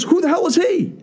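very high-pitched, incredulous outburst; indignant voice shooting way up; retold indignation. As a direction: throw out the question in acted outrage. Acts friend's indignant climax of questions.

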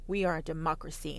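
normal talking voice

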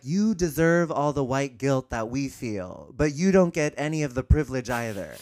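loud, indignant mock voicing; mock accusation. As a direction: throw their attitude back at them. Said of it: "be like".

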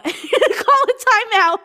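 high, slow, laughing; laughing repeat. Real, not acted. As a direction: burst out laughing on it. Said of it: "repeats laughing".

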